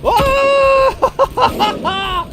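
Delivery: high, loud, fast panic; panicked screaming. Real, not acted. Tiger attacks, panic.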